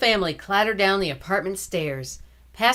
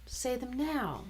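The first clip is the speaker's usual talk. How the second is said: gentle hush; 6 semitones higher, 10 dB quieter than usual. Acted. Sister soothing brother.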